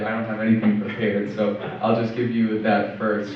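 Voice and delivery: normal talking voice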